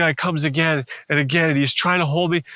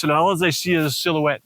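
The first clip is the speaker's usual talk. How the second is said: ordinary talk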